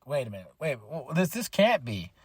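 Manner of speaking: soft, quick stunned muttering; stunned disbelief. Retold or imitated. Fan watching a wrestler limp out, quietly stunned. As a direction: mutter it like you can't believe your eyes. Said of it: "I'm like".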